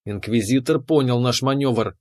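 usual speaking voice

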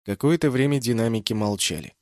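ordinary delivery, nothing marked

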